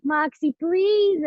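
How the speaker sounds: high, drawn-out mock plea; retold pleading. Student begging partner to practise later, pleading.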